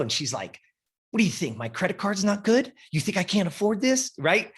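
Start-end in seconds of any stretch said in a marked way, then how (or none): 1.1-4.1 imitating an offended client, higher voice; mock offended. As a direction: play her huffy indignation, pitched up. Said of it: "She's getting pissed off".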